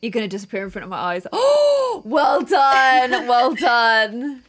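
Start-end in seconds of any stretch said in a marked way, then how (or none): 1.3-1.9 high, loud gasp; big startled gasp. Real, not acted. Player reacts to near mishap in game. 1.9-4.4 loud, drawn-out "well done"; loud, stretched mock congratulation; loud exclamation. Real, not acted. Reacting to another player in game.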